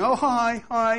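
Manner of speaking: high, loud, chirpy mock hello; fake friendly greeting. Preacher mimics coworkers greeting falsely, bright and loud. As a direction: ham up a sugary fake hello. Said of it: "They're going".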